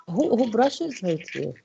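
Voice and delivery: normal talking voice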